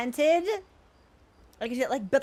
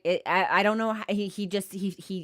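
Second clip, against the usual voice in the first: ordinary talk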